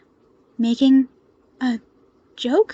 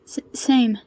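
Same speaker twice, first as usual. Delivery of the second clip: stammer. A narrator's reading. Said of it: "Kei stammered".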